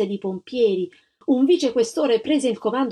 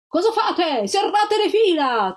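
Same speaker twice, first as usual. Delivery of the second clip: high, loud, slow command; thundering officers; 10 semitones higher, 3 dB louder, slower than usual. A narrator's reading. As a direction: thunder the order at retreating men. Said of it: "tuonarono gli ufficiali".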